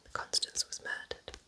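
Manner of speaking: ordinary talk, nothing marked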